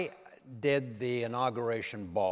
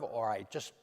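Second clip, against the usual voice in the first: ordinary talk, nothing marked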